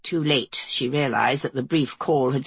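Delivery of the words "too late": plain reading voice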